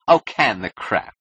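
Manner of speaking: slow, scornful brush-off; brusque dismissal. Acted. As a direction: cut off the flattery, slow and loud. Client cuts off his flattery.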